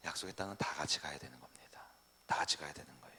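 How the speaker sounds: hushed, breathy whisper for emphasis. Real, not acted. Pastor whispering to the congregation that all enter together. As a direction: lean in and whisper the point.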